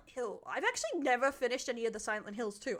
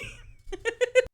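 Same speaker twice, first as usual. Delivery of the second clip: a burst of high, amused laughter; laughing hard at the meme; real laugh; 11 semitones higher, 6 dB louder than usual. Real, not acted.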